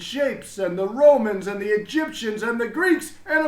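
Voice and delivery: a beaming principal proclaiming to everyone; proud headmaster announcing. A narrator's reading. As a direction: announce it grandly to the whole school. Headmaster gushing about learning.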